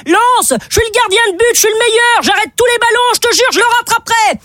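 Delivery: a cocky keeper shouting up to the balcon; boastful goalkeeper. Retold or imitated. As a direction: keeper calling up to the player, hammed-up boast.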